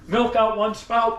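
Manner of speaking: normal talking voice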